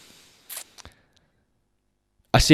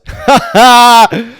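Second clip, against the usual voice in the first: loud laugh; 10 semitones higher, 8 dB louder than usual. Real, not acted. Laughs at own joke.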